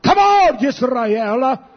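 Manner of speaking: a sudden pulpit cry; exhorting shout. Real, not acted. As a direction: throw it out to the congregation. Preacher exhorting the congregation.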